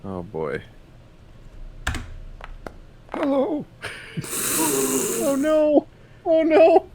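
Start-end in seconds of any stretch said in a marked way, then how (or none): none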